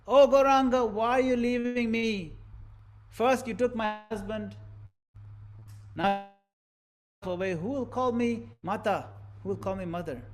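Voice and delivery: a high, loud wailing cry that fades into sorrow; grieving mother's lament. Acted. Mother begs her departing son, grieving lament. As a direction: plead with the son who is leaving you. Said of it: "She's saying".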